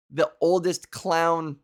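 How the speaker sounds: ordinary talk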